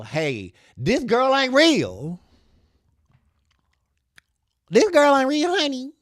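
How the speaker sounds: high, loud, drawn-out sassy warning; sassy announcement. Pretend. Telling shoppers the model isn't a real woman, high and sassy. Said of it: "telling people".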